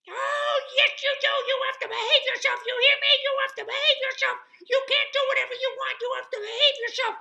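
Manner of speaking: tiny creature's very high, fast shriek; squeaky screaming. A narrator's reading. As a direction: scold at full squeak, very loud and rapid. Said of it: "started screaming in his squeaky, squeaky voice".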